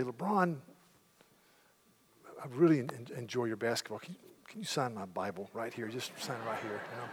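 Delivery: soft, starstruck fan asking for an autograph; hushed and bashful, like meeting a hero; a nervous fan asking LeBron to sign his Bible, softly; shy fan. Pretend.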